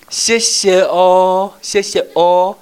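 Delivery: high, very loud, slow hammed-up thanks; mock-effusive thanks to the children; grateful teacher. Shown as a demonstration. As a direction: thank the class with big exaggeration. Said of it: "然后你就这样子".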